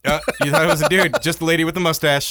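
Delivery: high, loud teasing; excited teasing. Real, not acted. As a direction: tease loudly and gleefully.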